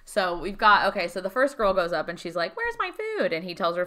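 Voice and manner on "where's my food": very high mock whine; demanding customer. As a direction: squeak it like an entitled diner. Customer demanding her food from chef.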